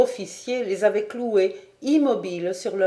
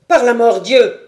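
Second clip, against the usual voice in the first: furious howl; 3 semitones higher, 11 dB louder than usual. A narrator's reading. Sergeant howls at the lieutenant's grip, furious. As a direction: bellow the curse in pain and rage. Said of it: "hurla le sergent".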